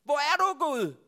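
loud, high cry to God; shouting. Acted. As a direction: call out searchingly at full voice. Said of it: "Undskyld, jeg råber sådan".

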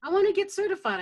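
loud, high-pitched insistence; determined. Retold or imitated. Coach recalling why she stayed at the event, loudly determined. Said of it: "Determined like that".

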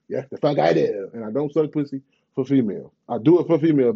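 ordinary delivery

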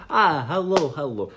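put-on greeting in an accidental Russian accent. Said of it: "That's Russian".

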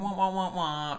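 high descending "wah" notes; sad trombone sound. Pretend. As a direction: voice a failure sound effect. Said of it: "wish we had that sound effect".

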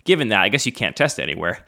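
ordinary talk, nothing marked

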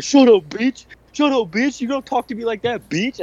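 high, hammed-up yelling; mock angry boyfriend. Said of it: "telling her".

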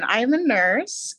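ordinary delivery

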